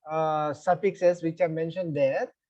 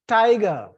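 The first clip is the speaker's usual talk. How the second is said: "tiger" slow and louder, first syllable stressed; 6 dB louder than usual. Shown as a demonstration. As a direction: lean hard on the start of "tiger". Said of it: "the first syllable is stress".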